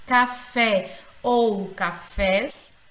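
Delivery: Café ou cafés slowly, each FÉ stretched; stress on last syllable. Shown as a demonstration. Teacher giving oxítona examples, Café ou cafés slow, stressed on FÉ. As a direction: hold the final fé in Café and cafés. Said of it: "é a sílaba mais forte".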